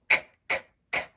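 whispered k sound, repeated. Shown as a demonstration. Teacher sounding out kids for class, whispered k. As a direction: make the k sound unvoiced, k, k. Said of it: "It's actually a K".

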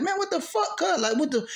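high-pitched, reenacted bewilderment; acted-out confused questioning; baffled exasperation. Reenacts baffled reply to wailing cousin.

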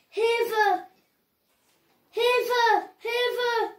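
high-pitched, repeated calls for help; acted cry for help. Kidnapped Tom calls for rescue, crying out high. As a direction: call out high, each call on its own. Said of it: "schrie Tom".

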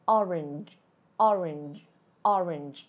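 "orange" falling, closed with an English j; final j sound. Shown as a demonstration. Teacher drilling the tail sound for viewers, correct "orange". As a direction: model "orange" with OR strong and a j ending. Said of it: "Âm j ở đuôi nha".

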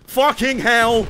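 loud, high startled swearing; real yell of alarm; shocked outburst. Real, not acted. Gamer caught by a homing missile, shocked shout.

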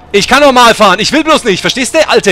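loud, high, punchy comeback; angry retort. Pretend. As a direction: snap back loudly at the nagging passenger. Retorts angrily to complaining passenger.

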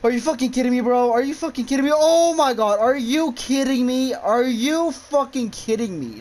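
high, loud, frantic disbelief; panicked shouting. Real, not acted. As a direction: yell in real panic. Panicked after a scare.

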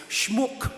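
"Schmuck" said the German way, with an oo vowel; German pronunciation. Shown as a demonstration. Priest shows congregation the German word "Schmuck", pronounced with German oo. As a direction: say "Schmuck" as in German, rhyming roughly with book. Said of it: "pronounce it the German way".

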